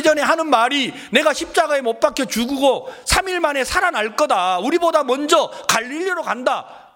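high, heated complaint in a mock disciple's voice; confrontational. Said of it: "예수님에게 따지듯이 말하잖아요". (따지듯이 목청 높인 하소연)

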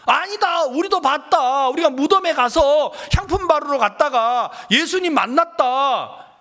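high-pitched, breathless-news style retelling; excited insistence. Retold or imitated. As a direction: imitate women insisting on what they saw. Women insist they saw Jesus. (높은 목소리로 들떠서 우기는 흉내)

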